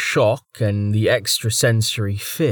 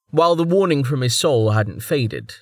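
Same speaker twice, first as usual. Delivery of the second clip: plain reading voice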